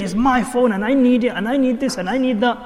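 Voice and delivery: high, insistent mock-teen complaint; demanding teenager. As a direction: rattle off demands like an entitled teen. Mimics privileged teenager demanding things.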